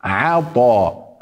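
عطاء slow and thin on the ع; عطاء بعين مرققة; light ain. Shown as a demonstration. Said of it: "قلنا ع".

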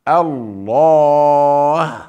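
الله with a full, heavy, long drawn alif, slow; heavy Allah. Shown as a demonstration. Teacher showing glorification in the name الله, slow and heavy. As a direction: stretch الله with a thick emphatic alif. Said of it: "نقول بدل الله نقول".

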